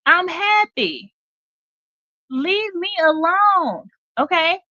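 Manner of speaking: high, slow gloating; gloating reel voice. Retold or imitated. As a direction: savour every word, slowly. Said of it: "He says".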